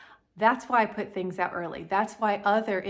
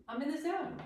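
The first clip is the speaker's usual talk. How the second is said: higher, softer, rising sing-song; 7 semitones higher, 8 dB quieter than usual. Shown as a demonstration. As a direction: lilt it up playfully.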